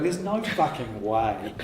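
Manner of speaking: low, drawn-out disbelief; slow, emphatic mock incredulity; retold disbelief. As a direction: stretch it out slowly, low in the voice.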